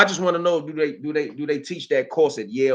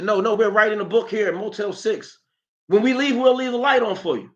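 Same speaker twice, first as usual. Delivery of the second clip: high, higher mock voice pleading with police; playing innocent, pitched way up; mock innocent excuse; 6 semitones higher than usual. Mocking [name] pleading innocent to the police.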